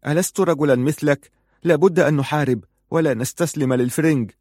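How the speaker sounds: indignant protest; angry retort. A narrator's reading. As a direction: throw the challenge back at him. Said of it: "قال في غضب".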